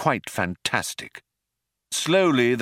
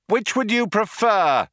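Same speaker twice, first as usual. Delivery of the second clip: high, raised shout back; shouted reply; 12 semitones higher than usual. A narrator's reading. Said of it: "shouted Ford".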